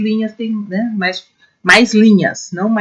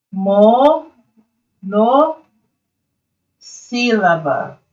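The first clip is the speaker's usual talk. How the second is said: "Monossílaba" very slowly, with long gaps inside; drawn-out syllables; much slower than usual. Shown as a demonstration. Teacher answering her own question for pupils, "Monossílaba" broken slowly into parts. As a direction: stretch "Mo", pause, "no", pause, then "sílaba".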